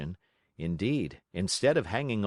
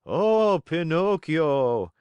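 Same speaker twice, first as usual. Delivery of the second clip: high, loud, slow wailing plea; tearful cry; 7 semitones higher, 7 dB louder, much slower than usual. Acted. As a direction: fake tears to win pity. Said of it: "he cried in a tearful voice".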